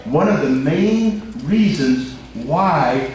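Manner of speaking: slow, stretched preaching with soaring stresses; emphatic preaching. Real, not acted. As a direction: proclaim it to the congregation, word by word. Preacher at full force.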